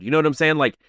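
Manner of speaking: usual speaking voice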